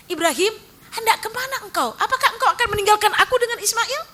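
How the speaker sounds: high, loud, rushing plea; Hajar's pressing plea. Acted. Wife pleading not to be left behind, high and urgent. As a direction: press him with a high worried voice. Hajar asking Ibrahim again.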